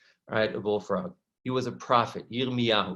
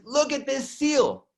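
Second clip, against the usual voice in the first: excited showing; 11 semitones higher, slower than usual. Retold or imitated. Archaeologist shows the find.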